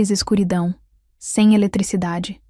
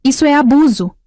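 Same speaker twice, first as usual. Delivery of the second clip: loud, high-pitched cry of outrage; indignant yell, pitched up; outraged shout; 5 semitones higher, 5 dB louder than usual. Acted. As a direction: shout the accusation at full tilt. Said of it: "Ela gritou ainda mais alto".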